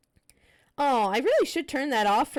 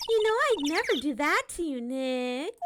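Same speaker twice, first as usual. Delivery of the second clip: put-on higher, bubbly teenage girl voice, teasing; Maya character voice; 6 semitones higher than usual. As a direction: play the cheeky girl sidekick, pitched up. Voicing Maya again.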